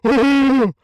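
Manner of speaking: shrieking high laugh; loud laughing. Real, not acted. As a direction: burst out laughing loudly. Reaction to Matt being bald.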